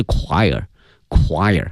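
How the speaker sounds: "choir" with CH said as a k sound; pronunciation demo. Shown as a demonstration. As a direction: say "choir" slowly, opening on a hard k. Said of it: "它是发k这个音哈".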